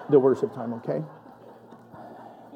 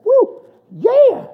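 very high, loud whoop like a Spirit-filled churchgoer; Southern church whoop, high and loud; Southern church shout; 7 dB louder than usual. Retold or imitated. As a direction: wave the hanky and holler it out. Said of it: "Spirit-filled hanky things to be like".

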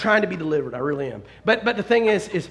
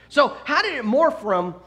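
normal talking voice

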